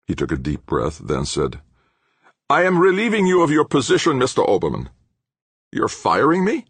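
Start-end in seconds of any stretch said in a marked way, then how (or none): 2.5-4.9 high, loud pronouncement dropping at the name; angry dismissal. A narrator's reading. Professor relieving his engineer of his position, angrily. 5.7-6.7 high-pitched shocked question; indignant disbelief. A narrator's reading. Engineer hearing he is fired, indignant disbelief.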